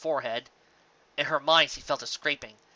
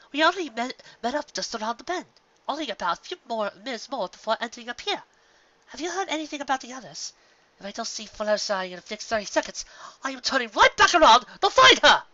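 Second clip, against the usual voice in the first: high, refined lady voice; fussy Rarity; 10 semitones higher, 4 dB louder than usual. Acted. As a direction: fret about a missing friend. Rarity voiced, far timbre.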